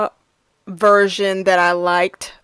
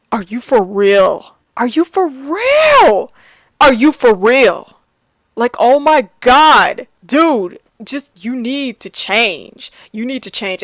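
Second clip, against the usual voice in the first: louder and higher, incredulous at Scrooge; exasperated disbelief; 3 semitones higher, 4 dB louder than usual. Retold or imitated. The viewer yelling at stubborn Scrooge, exasperated and incredulous. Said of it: "I'm like".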